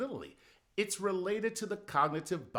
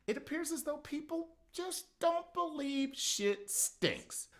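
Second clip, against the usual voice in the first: exasperated squeal; 13 semitones higher than usual. Pretend. Exasperated high comic voice.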